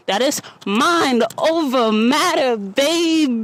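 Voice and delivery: high, loud, slow hype call; hyped exclaim. Real, not acted. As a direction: belt it out like a sign-off. Loud high punchline outro.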